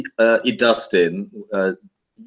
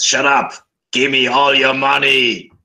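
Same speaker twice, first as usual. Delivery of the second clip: bickering voices in one; 4 semitones higher than usual. Acted. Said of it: "And the other head says".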